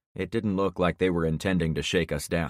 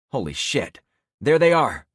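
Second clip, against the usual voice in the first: loud alarmed shout; 7 semitones higher, 7 dB louder than usual. Acted. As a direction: yell out in alarm. Spots the jeep.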